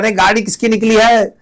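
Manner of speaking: teacher voicing kids yelling about the car; kids shouting. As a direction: call out like a startled kid at midnight. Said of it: "आधी रात को बच्चे चिल्ला रहे हैं".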